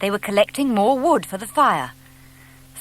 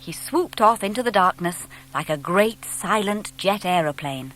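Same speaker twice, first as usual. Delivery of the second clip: ordinary delivery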